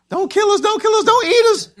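high, loud terrified pleading; screaming bloody murder. Acted. Disciples begging the approaching ghost, terrified scream. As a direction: plead for your life in a shriek. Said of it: "they're screaming for bloody murder".

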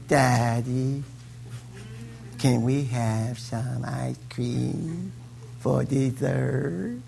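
put-on soft, slow pleading child; sweet little girl. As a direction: coax Daddy sweetly, drawn out. Little daughter sweetly asking Daddy.

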